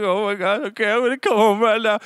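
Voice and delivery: high-pitched retold near-cry; holding back tears. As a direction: act out a dad trying not to cry. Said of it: "trying not to cry".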